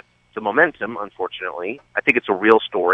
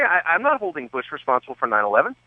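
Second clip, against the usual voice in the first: ordinary talk, nothing marked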